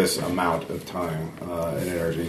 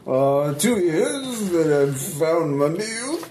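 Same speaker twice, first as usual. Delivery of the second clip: higher-pitched, slow painter voice; dreamy painter; 10 semitones higher, slower than usual. Acted. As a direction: boast dreamily, drawing words out. Game master plays painter Miles.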